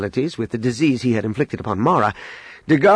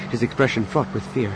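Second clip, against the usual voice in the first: usual speaking voice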